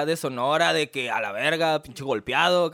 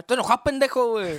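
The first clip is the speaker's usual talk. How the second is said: mock angry; 8 semitones higher, 3 dB louder than usual. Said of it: "pasaba mucho".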